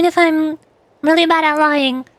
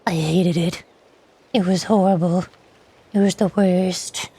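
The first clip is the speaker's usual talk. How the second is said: dropped much lower, dragged out slowly and grumbling; mock disgust; 7 semitones lower, slower than usual. Gamer grumbling to chat about hating Among Us, in a mock deep slow voice.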